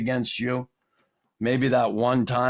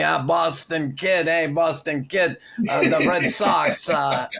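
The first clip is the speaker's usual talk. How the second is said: slow, higher-pitched impression of the prof; Ron Caron; 4 semitones higher, slower than usual. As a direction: hail the kid with stretched words and "uh"s. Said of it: "he'd start talking to me".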